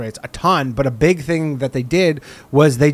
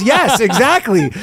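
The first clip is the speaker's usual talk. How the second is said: high, slow, laughing confirmation; laughing agreement; 8 semitones higher, 3 dB louder, slower than usual. Retold or imitated. Utah tourist admitting he was scammed, laughing ruefully. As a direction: chuckle through a rueful admission.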